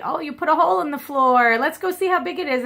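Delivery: a touch of playful cheer; cheerful grandma. Retold or imitated. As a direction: coax the kids into making a game of it. Her mom cheering fallen grandkids.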